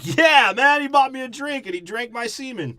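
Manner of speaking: high, loud hammed-up cheer; elated whoop. Sailor bragging to his mates, mock elated shout. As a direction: crow it like a thrilled, relieved sailor.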